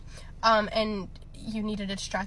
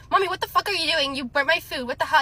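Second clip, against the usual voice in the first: raised, indignant imitation of a tantrum; higher, louder retold complaint, not a full scream; mild mock scream; 5 semitones higher, 5 dB louder than usual. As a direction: scold mommy in mock outrage. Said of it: "he screamed at her".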